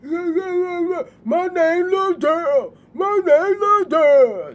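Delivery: loud, high, slow caricature; mock someone talking through a twisted mouth; hammed-up twisted-mouth holler, stretched out. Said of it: "she talk with her mouth twisted".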